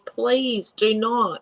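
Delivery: very slow, each word spaced out; pleading warning. Retold or imitated. To scam victims, a slow emphatic warning. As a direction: spell it out slowly like a firm plea. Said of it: "And I'm like".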